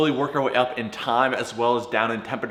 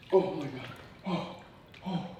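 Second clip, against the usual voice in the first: breathless from cold; 5 semitones higher, 9 dB quieter, much slower than usual. Real, not acted. Enduring freezing cold water.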